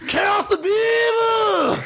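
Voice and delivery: high, held battle cry; anime attack shout. Pretend. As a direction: yell it like an anime special move.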